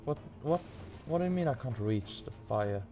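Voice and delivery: normal talking voice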